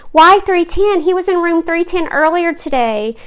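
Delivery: baffled query with a lifted voice; puzzled. A narrator's reading. Mother questions the nurse about the room, puzzled. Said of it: "said Mom strangely".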